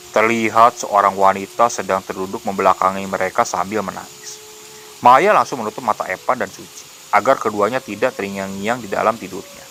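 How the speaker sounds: plain reading voice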